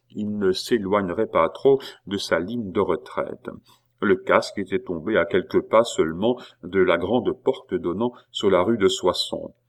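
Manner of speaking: ordinary delivery, nothing marked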